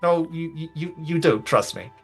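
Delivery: usual speaking voice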